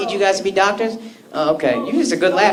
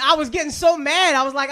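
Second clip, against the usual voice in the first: usual speaking voice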